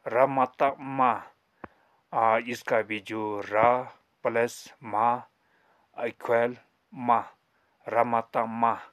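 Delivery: र जतक जा, slowly, syllable by syllable; slow demo reading. Shown as a demonstration. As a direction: sound it out for the class. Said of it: "र मत मा करके उच्चारण निकलते हैं". (धीमे-धीमे अक्षर जोड़कर पढ़ना)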